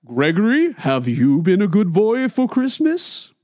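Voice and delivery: lightly voiced jolly Santa. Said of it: "the Santa Claus bit".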